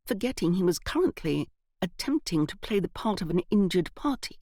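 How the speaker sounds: ordinary delivery, nothing marked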